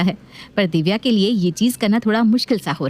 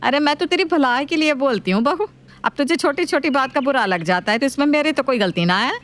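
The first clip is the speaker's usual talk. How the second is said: nagging mother-in-law voice; 6 semitones higher than usual. Acted.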